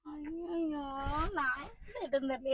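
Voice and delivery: despairing lament. Acted. Mother at a loss over her son's antics, quietly despairing. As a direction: wail weakly under your breath.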